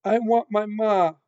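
high, loud wail of longing; crying out. A narrator's reading. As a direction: cry out high for a lost mother. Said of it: "cried Mig into the silent".

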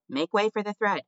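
high-pitched crier's call; cried out. A narrator's reading. Mouse pushing the spool through the crowd, crying out. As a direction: call out to clear a path. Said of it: "cried a mouse".

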